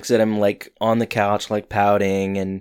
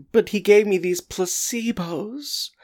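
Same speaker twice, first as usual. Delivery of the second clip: whiny defence; 10 semitones higher, 3 dB quieter than usual. Retold or imitated. Corey defends placebos, retold.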